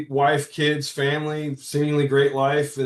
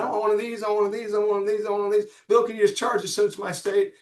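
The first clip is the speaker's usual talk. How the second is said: imitating [name]; 8 semitones higher, faster than usual. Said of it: "he pointed".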